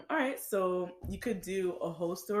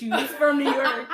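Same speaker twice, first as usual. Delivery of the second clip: higher, incredulous laughing question; 7 semitones higher, 5 dB louder than usual. Real, not acted. Friend mocking people claiming New York, laughing. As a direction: crack up on the question.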